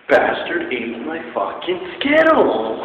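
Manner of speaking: high, loud, slow indignant rant; mock outrage. Real, not acted. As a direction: drag it out, outraged. Ranting about stolen candy.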